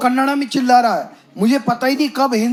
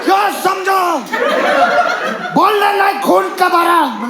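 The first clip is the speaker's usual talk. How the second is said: much higher shouted broken Hindi; mock driver shout; 10 semitones higher than usual. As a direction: fire back like the angry driver.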